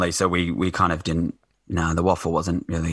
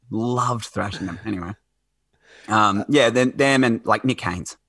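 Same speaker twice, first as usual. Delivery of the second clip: ordinary delivery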